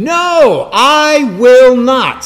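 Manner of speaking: loud, high, very slow No, I will not; emphatic refusal. Acted. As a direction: hammer out each word of refusal. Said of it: "And emphatically again".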